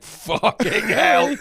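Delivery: drawn-out, high exclamation of disbelief; stunned exclamation. Retold or imitated. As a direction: draw the swear out slowly, high, in mock shock. Stunned reaction to retold remarks.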